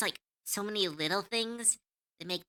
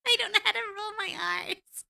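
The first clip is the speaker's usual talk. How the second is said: high, louder laughing complaint; 13 semitones higher, 5 dB louder than usual. Real, not acted. Laughing at failing to roll her R's. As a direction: giggle it out, much higher. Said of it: "sounds like I'm growling".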